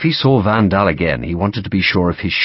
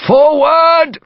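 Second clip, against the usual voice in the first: high, loud battlefield shout; charge order; 6 dB louder than usual. A narrator's reading. Officer driving running redcoats on, shouting. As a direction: shout them forward into the charge.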